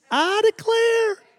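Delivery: very high, slow Southern belle "I declare"; Southern aunt drawl. Retold or imitated. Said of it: "her other thing that she said".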